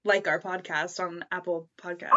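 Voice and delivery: ordinary talk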